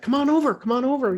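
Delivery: high beckoning call; friendly high call across traffic; calling him over. Retold or imitated. Waving homeless man over at light.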